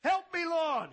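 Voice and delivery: high, loud yell of help; man hanging from a branch yelling for help; cry for help. Acted. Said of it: "He says".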